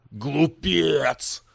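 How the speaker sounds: growled demon insult; demon snarl. Acted. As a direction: snarl the word at him. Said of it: "зарычал демон".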